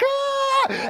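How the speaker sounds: sharp high yelp of alarm; sudden high cry of panic; startled yelp. Retold or imitated. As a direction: yelp as the rocket goes off. Fireworks going off indoors.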